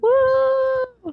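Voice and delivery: high, loud celebratory woo; excited woo. Real, not acted. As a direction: whoop for the party. Cheering Pride Month.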